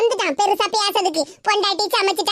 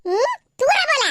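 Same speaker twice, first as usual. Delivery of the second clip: shooing him away; 3 semitones higher, slower than usual. Acted. Mother shoos son away.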